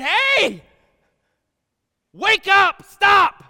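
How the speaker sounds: high, loud calling out to wake them; shouted wake-up call from Jesus; urgent shout. Acted. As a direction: yell it like a wake-up alarm.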